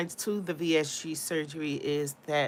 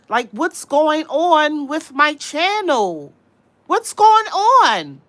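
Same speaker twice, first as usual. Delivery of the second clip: much higher and louder, slow; exasperated outburst; 10 semitones higher, 13 dB louder, much slower than usual. Real, not acted. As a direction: drag it out in high exasperation. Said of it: "And I don't talk like this".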